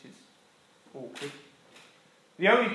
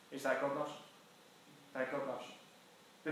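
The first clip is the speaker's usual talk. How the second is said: much softer and slow, almost hushed; hushed earnest; 3 semitones lower, 16 dB quieter, much slower than usual. Real, not acted. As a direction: lean in and say it quietly, sincerely. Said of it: "key message, hushed".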